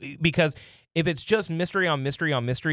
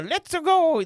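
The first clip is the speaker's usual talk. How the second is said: high cartoony plumber voice; squeaky Mario cheer; 13 semitones higher, 4 dB louder than usual. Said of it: "do Mario again".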